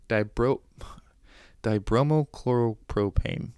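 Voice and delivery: ordinary talk, nothing marked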